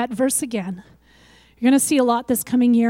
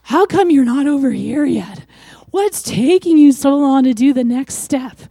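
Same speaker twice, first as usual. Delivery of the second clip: louder, impatient demanding call; impatient scolding; 6 dB louder than usual. Retold or imitated. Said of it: "He's not going".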